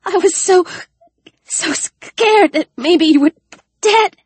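high, halting crying voice; frightened girl breaking down; tearful. A narrator's reading. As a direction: sob it out in broken pieces. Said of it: "Alex felt the splash of tears".

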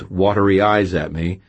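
usual speaking voice

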